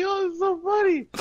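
high, cracking up through the words; can't hold back the laugh. Real, not acted. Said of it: "I couldn't help but laugh".

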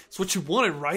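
tough street-guy delivery; thug voice. Retold or imitated. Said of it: "like, thug?".

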